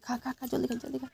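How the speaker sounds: hush-voiced hurry up; soft urging. Real, not acted. Mother softly urging the child to eat quickly.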